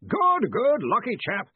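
high, hearty yell; blustering, not listening at all. A narrator's reading. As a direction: cut in with a jovial shout. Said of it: "Ridcully yelled".

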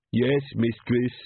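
slow, strange servile reply; Igor servant voice. A narrator's reading. Igor answers the mother, slow and servile. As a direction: grovel to the mistress.

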